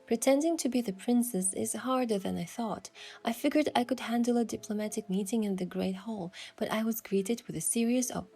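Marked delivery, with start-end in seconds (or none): none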